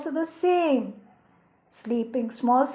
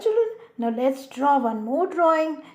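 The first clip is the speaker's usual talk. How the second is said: ordinary talk, nothing marked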